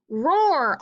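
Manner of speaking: a high, loud mock roar; bear roar. A narrator's reading. Bear losing at dreidel, loud playful roar. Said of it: "Old Bear roared".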